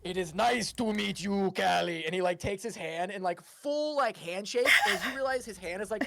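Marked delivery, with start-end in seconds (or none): 0.0-2.1 slow, heavy strongman voice; strongman Bork. Acted. Strongman Bork booming to the musician. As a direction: play the gentle giant, slow and weighty.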